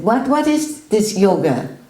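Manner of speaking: imitating the guru grilling a visitor; loud grilling. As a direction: press him hard on every word. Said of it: "And he said".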